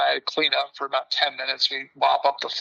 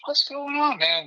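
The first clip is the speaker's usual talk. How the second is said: incredulous; 12 semitones higher than usual. Retold or imitated. Walks up to the scene.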